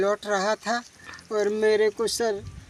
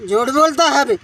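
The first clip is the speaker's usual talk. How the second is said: the same rebuke again, louder, a near yell; retold shout; 4 semitones higher, 7 dB louder, faster than usual. Victim retelling the policeman shouting him down, louder yell. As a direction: push it harder, shouting him down.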